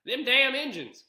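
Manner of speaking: loud, high mock Southern accent. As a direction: lay on a thick Southern accent, mocking. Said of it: "he turned on the Southern accent".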